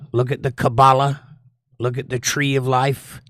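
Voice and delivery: usual speaking voice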